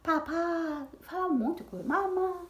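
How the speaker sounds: lightly voiced high toddler syllables; baby babbling. Mother imitates her toddler son's babble, high baby voice. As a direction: coo the syllables like a baby trying to talk. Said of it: "ele falava bastante sílaba".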